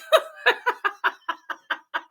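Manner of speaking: airy, high-pitched helpless laugh; breathless high giggle. Real, not acted. Speaker losing it at coworker's joke, airy giggling. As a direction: let the giggle run out of breath. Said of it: "couldn't stop laughing".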